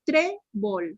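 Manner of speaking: "Trébol" in two parts, TRÉ stressed; syllable-by-syllable. Shown as a demonstration. Teacher asking where the accent goes, "Trébol" split with TRÉ stressed. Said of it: "la pronuncien por sílabas despacito".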